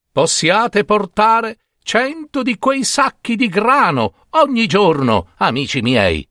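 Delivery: high, slow model phrase from mother; mother quoting the blessing. Acted. Mother teaches Babino what to say, high and slow. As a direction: show him the proper wish. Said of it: "lo rimproverò".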